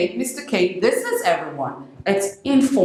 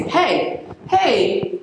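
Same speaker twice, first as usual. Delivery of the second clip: breezy higher hey; too-casual hey; 6 semitones higher than usual. Shown as a demonstration. Meeting the boss for the first time, too-casual hey. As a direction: sound overly friendly. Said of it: "and you say".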